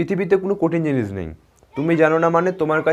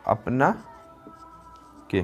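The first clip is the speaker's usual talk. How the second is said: drawn out answer; 2 semitones lower, 6 dB quieter, much slower than usual. Shown as a demonstration. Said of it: "আর এখানে বলি".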